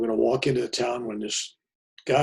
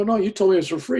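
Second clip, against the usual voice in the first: indignant protest; 9 semitones higher, 8 dB louder, faster than usual. Retold or imitated. Narrator protesting.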